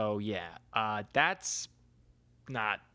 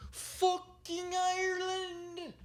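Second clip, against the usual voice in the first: stretched, much higher, slow cry of joy; excited squeal; 3 dB quieter, much slower than usual. Real, not acted. Telling listeners about his upcoming trip, excited slow high squeal. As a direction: squeal the word Ireland out slowly.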